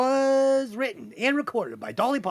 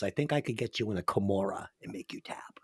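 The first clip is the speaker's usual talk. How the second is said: unvoiced mock threat; menacing whisper; 10 semitones lower, 7 dB quieter than usual.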